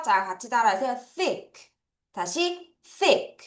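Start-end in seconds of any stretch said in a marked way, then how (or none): 1.2-1.5 "Thick" with a soft, high TH; "Thick" said high and soft, tongue out for the TH; TH pronunciation demo. Shown as a demonstration. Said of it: "티에이치 발음을 해야 돼요". 3.0-3.4 "thick" with TH between Korean ㅆ and ㄸ; high, slow model of the TH in "thick"; TH pronunciation demo. Shown as a demonstration. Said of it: "쌍쇼과 쌍디귿의 중간 발음입니다".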